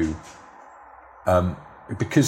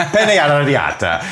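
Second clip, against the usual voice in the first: hammed-up Italian accent, high and loud; pretentious diner overdoing the Italian accent; flourished Italian accent; 9 semitones higher, 8 dB louder than usual. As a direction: put a massive Italian flourish on it. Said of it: "put a sort of massive flourish".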